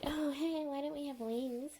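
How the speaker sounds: high-pitched imitation of a curious girl; naive student. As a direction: lift the voice high for an innocent question. Acts out Bloom's naive question.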